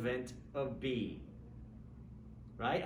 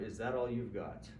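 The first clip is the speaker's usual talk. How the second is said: low-key, unfazed challenge; understated, showing no response to pain; 6 semitones lower, 4 dB quieter than usual. Acted. Teacher playing a kicked man hiding pain, unfazed. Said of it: "with no response".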